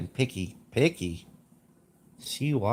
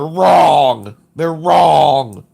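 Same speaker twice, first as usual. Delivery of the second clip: ranting shout; 3 semitones higher, 12 dB louder, much slower than usual. Real, not acted. Ranting about pronunciation.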